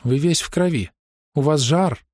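put-on high little girl's voice; child voice. A narrator's reading. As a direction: speak as a small girl. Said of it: "и детский голос ответил".

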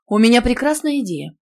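gleeful brainwave; suddenly perked up. A narrator's reading. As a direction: perk up with a sudden inspiration. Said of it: "вдруг взбодрился Аркадий Львович".

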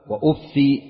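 وأفي with doubled f and a bare "i" ending; kasra without tanween. Shown as a demonstration. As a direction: pronounce وأفي as "wa-uffi". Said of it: "وكسرها بتنوين وبغير تنوين".

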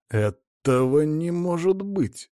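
slow, stunned outcry; can't believe his eyes; exclaiming in disbelief. A narrator's reading. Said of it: "воскликнул боец".

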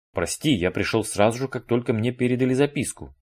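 usual speaking voice

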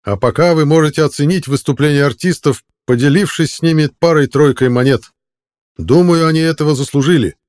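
loud, high showman's voice; announcer voice. A narrator's reading. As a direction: rouse the crowd like a host. Administrator voiced, continues.

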